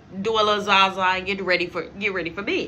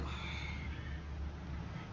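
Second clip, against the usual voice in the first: breathy exasperated exhale; sigh, totally fed up; long heavy sigh; 19 dB quieter than usual. Real, not acted. Fed-up reaction.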